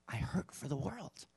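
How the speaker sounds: hushed anguish. Acted. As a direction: breathe it out like a quiet confession. Voicing Christ's suffering.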